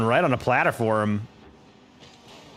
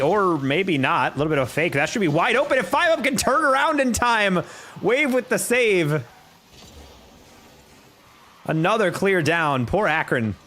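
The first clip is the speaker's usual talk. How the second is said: rising, much higher as the goal goes in; excited play-by-play; 7 semitones higher than usual. Acted. Caster to chat, hyped play-by-play call. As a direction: call the pinball goal with growing hype.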